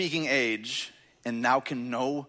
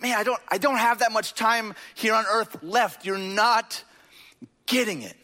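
mildly exasperated, higher than usual, breaking off before the last words; frustrated Jesus; 6 semitones higher than usual. Retold or imitated. Preacher voices Jesus running out of time on earth, frustrated. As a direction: voice Jesus's frustration at not being understood. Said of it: "I think he's frustrated".

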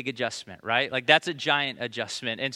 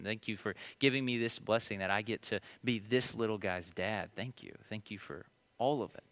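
soft, low, tender thanks; grateful prayer; 5 semitones lower, 7 dB quieter than usual. Retold or imitated. Father thanking God for his son, hushed tender prayer. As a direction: pray quietly over a sleeping baby.

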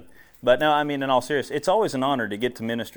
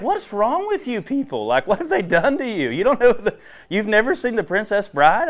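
mock disbelief; 10 semitones higher, 6 dB louder than usual. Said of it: "I was like".

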